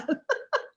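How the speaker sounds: laughing. Real, not acted. Laughing at her own story.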